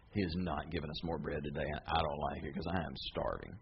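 low, soft grumble under the breath; grumbling mutter. Retold or imitated. Crowd members grumble to each other, low and soft. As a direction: complain quietly to your neighbor.

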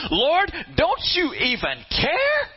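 high, drawn-out indignant wail; wailing victim. Acted. Martha interrupting to address Jesus, high wailing victim. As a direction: stretch it high into an aggrieved question. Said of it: "she is a victim".

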